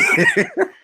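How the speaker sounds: loud squealing laugh; high laugh. Real, not acted. Laughing at his own joke.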